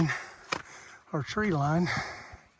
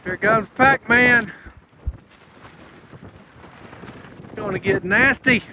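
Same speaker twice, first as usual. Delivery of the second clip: loud; 6 semitones higher, 7 dB louder than usual. Real, not acted. Fog rolling in, wind rising.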